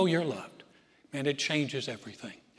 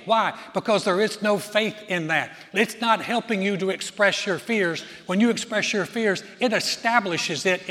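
loud, raised, emphatic preacher voice; full-force preaching; 4 semitones higher, 7 dB louder than usual. Real, not acted. Preacher urging congregation not to express fears, full-force preaching. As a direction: drive each point home, high and loud.

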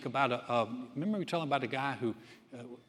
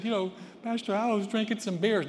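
high, louder sheepish voice of the man; mock confessing drinker; 8 semitones higher, 5 dB louder than usual. Man confessing to his pastor, in a high mock-sheepish voice. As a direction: play the man owning up to the beers, higher and louder.

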